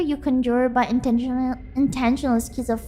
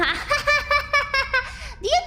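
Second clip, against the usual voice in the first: long run of high-pitched staccato laughter; squeaky giggling fit; high giggle; 13 semitones higher than usual. Real, not acted. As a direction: laugh in a high, rippling cackle. Laughs at own idea.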